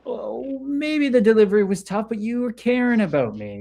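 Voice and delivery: a kind reframe spoken to yourself; understanding inner voice. Retold or imitated. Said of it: "it's like".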